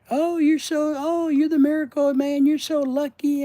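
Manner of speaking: high, loud syrupy mimicry; high, loud sugary mock praise; mocking gushing staff.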